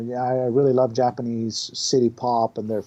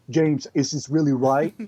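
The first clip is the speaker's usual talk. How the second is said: Schwarzenegger impression. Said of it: "Arnold asking the director".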